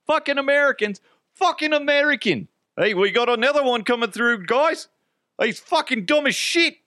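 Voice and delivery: very high, exaggerated Australian jeering; mocking Aussie accent. Australian customs men mocking an American, exaggerated Aussie accent. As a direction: heckle in a hammed-up Aussie accent. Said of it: "That's what they were saying".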